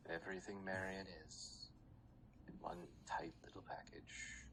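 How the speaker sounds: soft unvoiced whisper. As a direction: breathe it secretly. GM whispering Syndra's lines.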